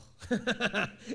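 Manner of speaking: a burst of real laughter; high, loud laugh. Real, not acted. Laughs at own retold answer.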